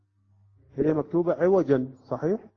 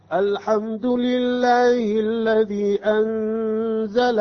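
slow chanted recitation, higher and louder, brief breathless pause after عوجا; recitation with sakt; 6 semitones higher, 5 dB louder, much slower than usual. Shown as a demonstration. Teacher recites joining the verses with a sakt. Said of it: "في حالة الوصل الآن نقرأ".

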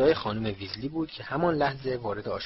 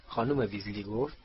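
ordinary delivery

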